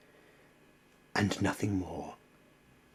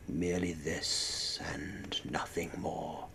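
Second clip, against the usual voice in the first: ordinary delivery, nothing marked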